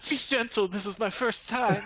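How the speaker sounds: mock shy virgin. Joking as if seduced.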